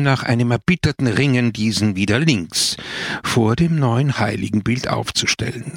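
ordinary delivery, nothing marked

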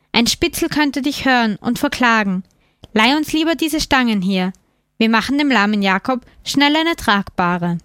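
ordinary delivery

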